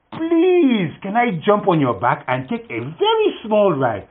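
very high, begging please, then slow; pleading scorpion. Acted. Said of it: "And she says".

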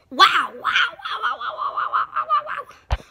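loud, delighted wows with laughter breaking through; excited wows. Real, not acted. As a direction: burst out in thrilled wonder, laughing. Unboxing reveal, repeated wows.

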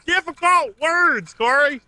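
slow, very high mock southern accent. Co-host teases his partner for losing it, mock southern accent. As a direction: draw it out in squeaky mock southern. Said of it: "talk in a southern accent really loud".

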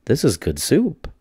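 savouring the taste; lightly voiced old woman; little old lady, pleased. A narrator's reading. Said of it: "she said".

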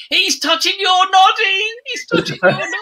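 high mock children's shout. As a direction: imitate a crowd of excited kids telling on someone. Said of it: "they're, they're shouting".

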